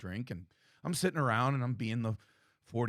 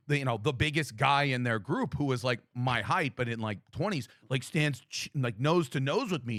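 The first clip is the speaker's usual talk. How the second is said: ordinary talk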